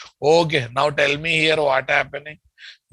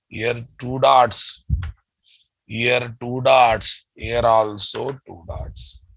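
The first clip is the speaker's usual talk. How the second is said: slow dictation; slower than usual. Shown as a demonstration. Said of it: "repeated slowly for class".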